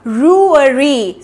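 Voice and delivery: रुअरी very slowly, u held long; slow syllable drill. Shown as a demonstration. Teacher isolating the ending of फेब्रुअरी. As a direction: hold the u in रुअरी. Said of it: "यू खिंचेगा".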